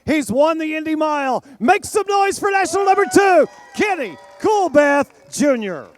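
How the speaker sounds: shouted arena hype over music; booming pumped announcer. Acted. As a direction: roar it to the crowd. Announcer introducing the winner to the crowd.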